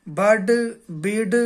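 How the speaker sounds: بڈ، بڈ stretched slowly, sound by sound; slow syllable drill. Shown as a demonstration. Teacher sounding out بڈ slowly for beginners. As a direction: draw out بڈ، بڈ deliberately. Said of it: "لہذا اسے پڑھیں گے".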